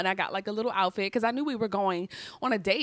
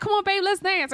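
excited urging; 11 semitones higher, 4 dB louder than usual. Retold or imitated. Urging her man to dance.

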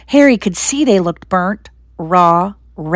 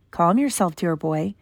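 much softer, gentle soothing; calm reassurance; 7 dB quieter than usual. Acted. Dumbledore gently soothes the anxious Harry in bed. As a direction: settle him down tenderly.

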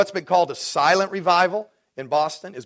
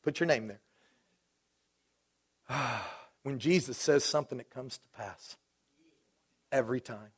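ordinary talk

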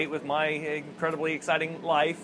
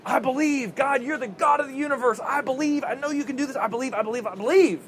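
higher-pitched, insistent repeated pleading; mock straining prayer; 6 semitones higher than usual. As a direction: act out willing yourself to believe. Acts out his straining prayer.

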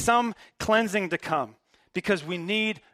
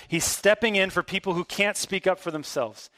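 ordinary talk, nothing marked

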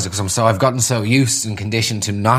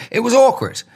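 exasperated; 7 semitones higher than usual. Real, not acted. Exclaiming about awkwardness.

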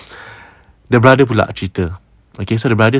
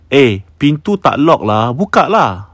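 louder, higher, drawn-out retort; annoyed call-out; 3 semitones higher, 3 dB louder than usual. Retold or imitated. Sisters to whoever keeps knocking, annoyed call-out. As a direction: call out impatiently through the door.

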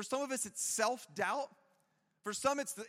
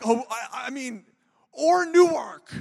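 louder, slower sheepish retreat with a laugh; backpedaling prayer; 3 semitones higher, 14 dB louder, slower than usual. Retold or imitated. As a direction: take it back fast, half joking. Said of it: "And then you're like".

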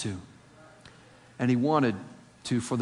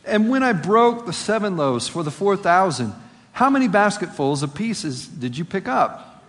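insistent questioning; 6 semitones higher, 4 dB louder than usual. Acted. Jesus keeps quizzing the disciples.

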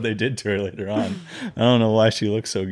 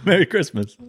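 higher mock New York Italian; movie-mobster Italian accent; Italian-American accent; 9 semitones higher than usual. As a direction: throw out catchphrases in hammed-up Italian-American. Joke round of Italian-American accents.

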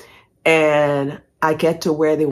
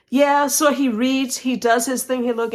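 plain reading voice

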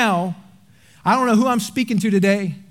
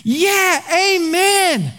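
eager amen; 8 semitones higher, much slower than usual. Retold or imitated. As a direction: cheer him on from the pew with a high "amen". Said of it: "doing my best sitting right there going".

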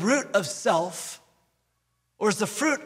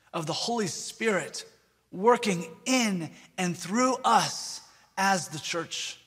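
ordinary talk

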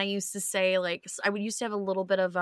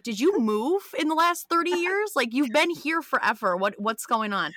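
teasing disbelief; 6 semitones higher than usual. Retold or imitated. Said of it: "we're like".

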